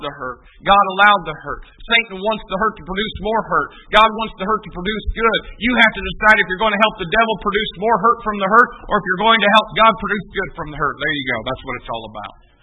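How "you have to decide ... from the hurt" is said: ordinary talk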